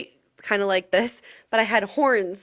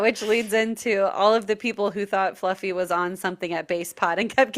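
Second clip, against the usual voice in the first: normal talking voice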